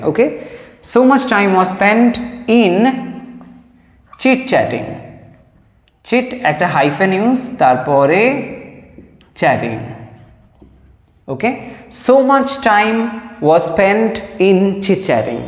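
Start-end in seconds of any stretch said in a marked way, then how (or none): 0.9-4.9 slow dictation. Shown as a demonstration. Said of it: "teacher dictates sentence slowly". 12.1-15.4 very slow, word by word for students to write; slow dictation. Shown as a demonstration. Teacher dictating an English sentence to students, very slow. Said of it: "try করো".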